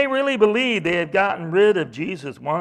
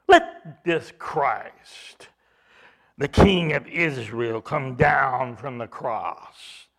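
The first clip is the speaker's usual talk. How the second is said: very slow, lower, drawn-out taunt; slow mockery; 6 semitones lower, slower than usual. Religious leaders taunting Jesus, very slow low scorn. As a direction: stretch each word out in contempt. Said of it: "were mocking him".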